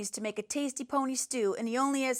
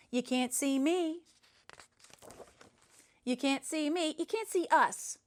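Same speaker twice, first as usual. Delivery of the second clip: playful taunt; 4 semitones higher than usual. Acted. Invisible dude taunts playfully.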